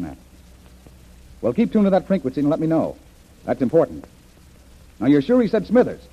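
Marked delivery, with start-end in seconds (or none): none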